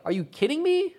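high, disbelieving "Are you kidding me?" with the pitch shooting up; incredulous. Retold or imitated. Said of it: "Or like, or like".

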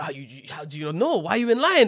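high, loud imitation of an exasperated customer; mock-outraged rant. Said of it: "he was so mad".

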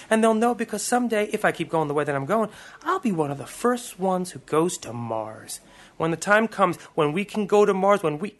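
rising excitement. Acted. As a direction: boasts his dream with growing fervour. Boasting about reaching Mars.

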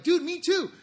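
high and loud, thrilled; retold delighted "me too" to the gym guy; excited recognition. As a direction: shout the connection. Shares same diabetes diagnosis.

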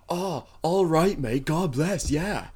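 loud, high, over-the-top Aussie; eccentric Aussie accent. Retold or imitated. Taco spot counter guy answering an order, eccentric Aussie accent. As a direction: imitate an eccentric Australian, big and drawn out. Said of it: "most eccentric Aussie I've ever met".

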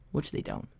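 a dry whisper under his breath; whispered aside. Real, not acted. Whispering an aside about the receptionists. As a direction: drop to a whisper for the jab.

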